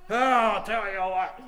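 loud, high outburst imitating a desperate man; agitated retelling. Preacher retelling a man's suicide threat, agitated.

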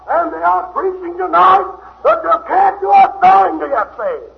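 loud, high preaching at full force; a revival preacher calling sinners home; fiery preaching. Acted. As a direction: thunder it out to the congregation. Preaching build.

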